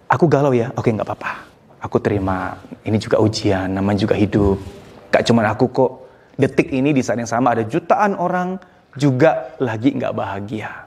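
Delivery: low, unhurried self-talk; soothing acceptance. Shown as a demonstration. Talking yourself through unhappiness, calm and accepting. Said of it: "Itu nenangin tau nggak?".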